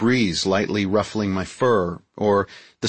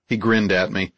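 ordinary delivery

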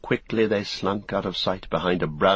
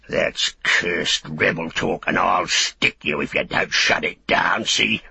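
threatening orc soldier; 3 dB louder than usual. Acted.

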